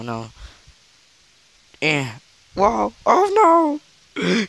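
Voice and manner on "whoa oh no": high, loud shocked yelp; startled cry. Real, not acted. Gamer startled as his opponent grapples him, loud high yelp. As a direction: yelp as the fight turns on you. Sudden shock in game fight.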